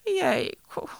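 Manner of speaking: high-pitched bear's "oh gosh"; dismayed little exclamation from a bear; gasped dismay. A narrator's reading. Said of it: "sapnął miś".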